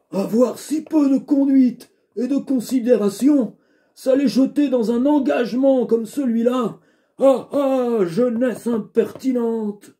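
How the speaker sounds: put-on high, cracking old man's grumble. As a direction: mutter like an indignant old father. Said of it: "une voix un peu vieux".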